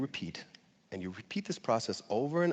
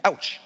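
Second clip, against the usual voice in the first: a high, loud mock Ouch; 7 semitones higher, 4 dB louder than usual. An American bumping his head, mock cry of pain. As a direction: act out a bump on the head. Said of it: "his initial reaction might be".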